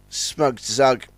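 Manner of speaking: plain reading voice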